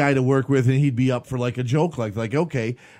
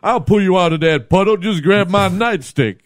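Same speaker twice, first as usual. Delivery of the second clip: Officer Shaq voice; 7 semitones higher, 6 dB louder than usual. Pretend. Playing Officer Shaq rescuing Bobby.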